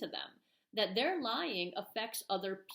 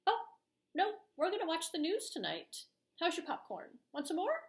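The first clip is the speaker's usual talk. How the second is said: cheerfully unbothered parent; 8 semitones higher than usual. Retold or imitated. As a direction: chirp it like it's no big deal. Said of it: "be like".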